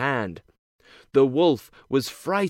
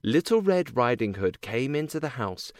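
ordinary delivery, nothing marked

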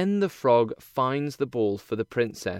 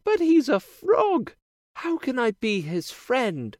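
put-on high, bright girlish princess voice; cartoon princess; 12 semitones higher than usual. A narrator's reading. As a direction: play a sweet young princess. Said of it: "asks the princess".

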